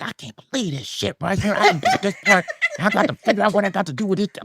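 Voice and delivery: high-pitched, retold inner freak-out; inner panic. Actor in the hallway handed the wrong part, panicking in his head. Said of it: "This is what's going on in my head".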